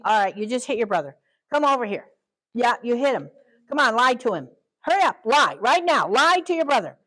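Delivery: put-on loud, high, bossy parent ordering a child around; mock traditional parent. Parent barking orders at the son who hit his brother. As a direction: scold the kid into a fake apology. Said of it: "And traditional parenting".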